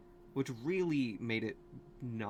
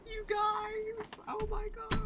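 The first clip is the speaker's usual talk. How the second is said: much higher squeal; excited squeal; much slower than usual. Real, not acted. Vlogger squealing to viewers about a surprise. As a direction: squeal with delight.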